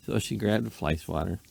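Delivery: plain reading voice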